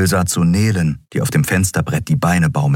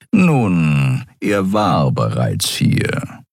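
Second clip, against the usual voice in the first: purring voice; much slower than usual. A narrator's reading. Suran voiced.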